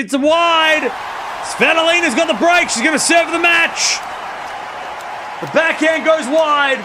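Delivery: high, loud eruption of joy at the break; excited shout. Real, not acted. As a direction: yell it out as the decisive point falls. Break point won, commentator erupts.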